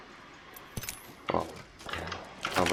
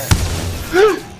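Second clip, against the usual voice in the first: shocked gasp; 9 dB louder than usual. Real, not acted. Shocked by a find.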